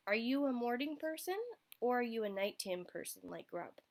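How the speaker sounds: plain reading voice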